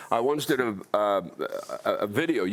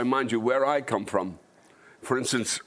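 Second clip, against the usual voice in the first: ordinary delivery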